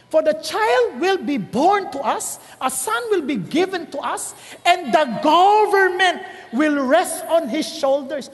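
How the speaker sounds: slow, weighty Bible reading; solemn scripture reading. Acted. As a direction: read it like holy scripture, slowly. (mabagal at taimtim na pagbasa ng Bibliya)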